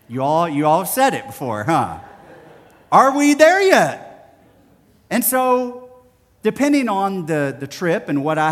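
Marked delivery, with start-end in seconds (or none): 2.9-4.4 put-on high, loud, slow child whine; whiny kid. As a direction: whine it like a bored kid in the back seat. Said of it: "And the phrase is what?".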